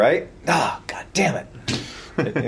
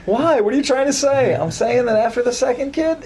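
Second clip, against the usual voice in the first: raised pitch, indignant partner; high mock wife voice; 10 semitones higher than usual. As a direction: squeak it out like an offended wife. Acted wife and husband exchange.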